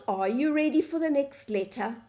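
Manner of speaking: ordinary delivery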